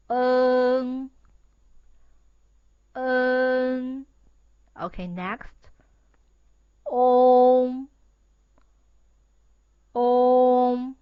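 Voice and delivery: on held long through the nose; nasal model finals. Shown as a demonstration. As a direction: sound it through your nose, drawn out. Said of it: "use your nose to pronounce".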